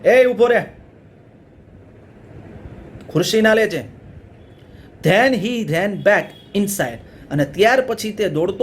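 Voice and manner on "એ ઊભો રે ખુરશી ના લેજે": shouting. Acted. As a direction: yell wait, don't take it. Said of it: "તે જોરથી બોલ્યો". (જોરથી બૂમ પાડીને)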